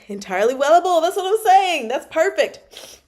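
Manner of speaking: loud, high-pitched excitement; delighted agreement. Real, not acted. Reader agreeing with chat about favourite expression, excited.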